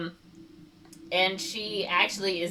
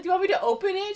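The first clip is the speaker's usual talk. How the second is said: very high, louder put-on offer; mock bellboy; 5 dB louder, much faster than usual. Bellboy offers to open her cases, mock bellboy voice.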